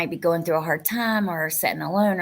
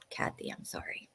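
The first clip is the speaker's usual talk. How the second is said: very soft, almost whispered apology; sigh, then barely voice the sympathy; hushed condolence; 17 dB quieter than usual. Real, not acted. Consoling a viewer, barely audible.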